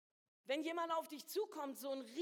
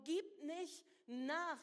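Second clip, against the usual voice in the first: slow urging; 3 semitones higher, much slower than usual. Acted. Preacher urging congregation.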